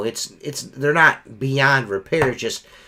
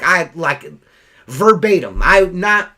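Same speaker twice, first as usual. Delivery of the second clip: ordinary talk, nothing marked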